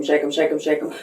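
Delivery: usual speaking voice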